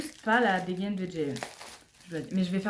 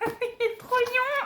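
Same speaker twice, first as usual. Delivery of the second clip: gushing; slower than usual. Real, not acted. Gushing over a member.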